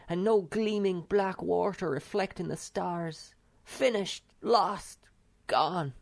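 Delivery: high, mournful lament; wailing Snufkin. A narrator's reading. Wanderer mourns the dried-up sea, high lament. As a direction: grieve for the lost sea. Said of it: "sat down with his head in his hands and wailed".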